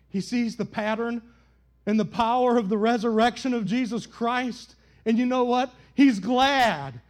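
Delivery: loud, high, rising preacher's cadence; impassioned pulpit preaching; full-force preaching. Acted. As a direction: build the sermon to a shout. Preaching builds again.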